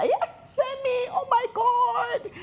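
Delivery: high, slow, tearful cry of delight; squealing with joy at the news; ecstatic squeal. Real, not acted. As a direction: shriek out oh my God overjoyed. Thrilled naming the couple.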